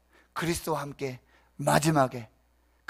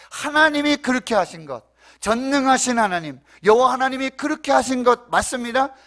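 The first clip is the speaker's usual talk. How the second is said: high, loud sermon delivery; forceful, raised preacher's voice; impassioned preaching; 9 semitones higher, 10 dB louder than usual. Real, not acted. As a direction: drive each phrase home from the pulpit.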